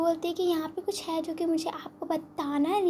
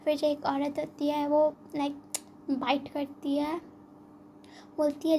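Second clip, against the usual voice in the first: ordinary delivery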